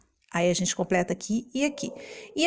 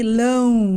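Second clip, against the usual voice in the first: LÃO held long and louder; drawn-out syllable; 6 dB louder than usual. Shown as a demonstration. Teacher asking which fruit ends so, LÃO held long. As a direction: stretch LÃO out as a word ending. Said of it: "termina desse jeito com lão".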